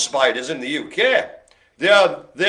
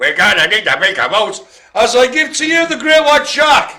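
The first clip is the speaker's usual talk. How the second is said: louder, higher hammed-up Australian accent sliding toward South African; mock Australian accent; 6 semitones higher, 7 dB louder than usual. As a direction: boom it out in a wonky Australian accent like a film line. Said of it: "this weird Australian accent that almost sounded like South African".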